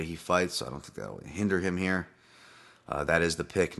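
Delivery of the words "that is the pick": normal talking voice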